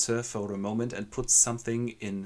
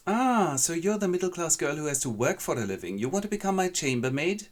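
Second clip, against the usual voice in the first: haughty lady; 8 semitones higher, 4 dB louder than usual. Acted. Lady mocks the girl.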